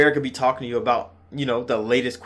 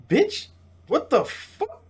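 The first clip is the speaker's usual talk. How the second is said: slow, high-pitched incredulous reaction; stunned disbelief; 12 semitones higher, much slower than usual. Retold or imitated. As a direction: draw out the shock, voice pitched way up. Acts out shocked reaction to death.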